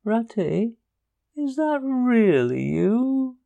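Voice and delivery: high, slow feeble little voice; a weak small voice from a hole; lightly voiced frightened mole. A narrator's reading. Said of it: "came a feeble voice saying".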